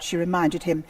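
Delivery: plain reading voice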